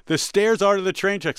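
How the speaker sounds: high, loud call to a crowd of strangers; retold yell. Lost traveller calling to strangers in a train station, yelling. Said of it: "I yell in the middle of the train station".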